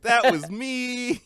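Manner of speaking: squeaky, drawn-out guilty admission; high slow mock confession. Imitating Danny admitting he was always late, high and slow. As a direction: pitch it way up and stretch it out sheepishly. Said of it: "Danny's like".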